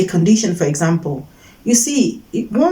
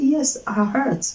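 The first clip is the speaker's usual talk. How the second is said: quiet admission of hurt; 3 semitones higher, 7 dB quieter, slower than usual. Retold or imitated. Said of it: "I say".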